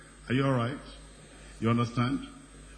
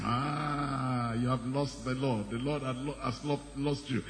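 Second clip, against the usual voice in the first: devil whispering; 4 dB quieter than usual. Retold or imitated. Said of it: "the devil has been whispering to you".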